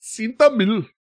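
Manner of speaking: put-on high-pitched Indian accent. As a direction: say the name in a thick Indian accent. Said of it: "legit Indian accent".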